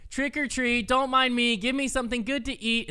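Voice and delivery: high, sing-song trick or treat chant; bold little girl. Retold or imitated. Niece chanting at old people's doors, high and sing-song. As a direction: chant it like a confident five-year-old.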